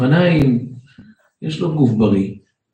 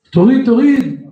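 "תוריד, תוריד" barked higher and louder; mock shout; 10 semitones higher, 4 dB louder than usual. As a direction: order him to strip, loud. Security guard orders him. (צעקה מחוקה)

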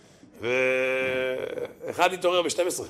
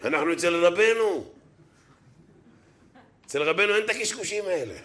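lightly laughing imitation; Kolorabi's voice; 5 semitones higher than usual. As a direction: mimic the Kolorabi unbothered. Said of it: "עם הקול של הקולורבי".

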